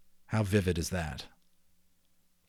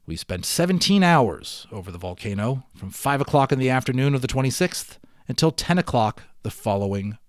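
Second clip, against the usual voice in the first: lively read-aloud storyteller; story narration; 4 semitones higher, 10 dB louder than usual. Acted. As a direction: tell the adventure with growing drama. Reads the adventure story aloud.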